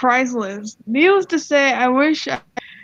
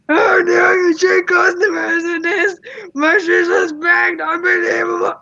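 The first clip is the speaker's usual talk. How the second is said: berserk scream; 6 semitones higher than usual. Retold or imitated. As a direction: ham up the customer losing it completely. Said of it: "goes berserk and screams".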